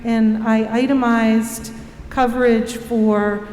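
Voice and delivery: ordinary talk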